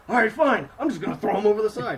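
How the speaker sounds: loud, fast, fed-up retort; angry Thorin. Retold or imitated. Thorin shouting back at his dwarves, loud and fast. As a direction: snap back and rush it.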